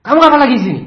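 high, loud testy demand; acted annoyed shout; irritated boss. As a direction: bark at the unwelcome worker. Angry boss greets next servant.

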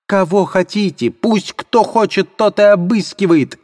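high-pitched defiant cry; shouting. Acted. Katerina daring anyone to search, high shrill shout. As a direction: shriek it at the whole room. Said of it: "кричала Катерина Ивановна".

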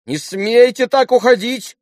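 high, loud, stretched command; crying out. A narrator's reading. Lise forbids Alyosha to leave, crying out high. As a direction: shriek an indignant girlish order. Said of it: "вскричала была Лиз".

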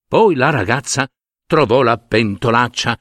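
ordinary delivery

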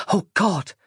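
high-pitched hopeless inner voice; despairing thought. A narrator's reading. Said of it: "thought Roz".

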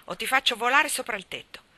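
put-on furious shout of a boy; yelling. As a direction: shout him down while shaking him.

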